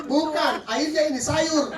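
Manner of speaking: high, imitated irritated correction; mock-cross customer; angry. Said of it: "Marah-marah lagi".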